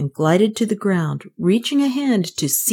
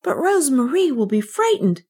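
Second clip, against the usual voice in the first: put-on high-pitched, earnest child; pleading; 7 semitones higher than usual. A narrator's reading. Kay begs the maid to help Rosemarie, pleading. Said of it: "Kay pleaded".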